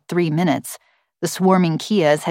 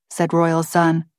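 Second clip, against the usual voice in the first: ordinary delivery, nothing marked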